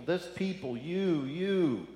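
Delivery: frustrated, exasperated sigh at his people; exasperated God. Retold or imitated. God scolding his people, mildly exasperated. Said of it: "You can hear the frustration".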